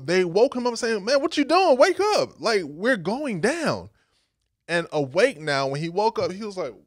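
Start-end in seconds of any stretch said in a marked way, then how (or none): 1.0-3.9 high, loud frantic wake-up call; panicked disciples. Retold or imitated. Disciples shouting to wake Jesus in the storm, panicked. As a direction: shake him awake in a panic.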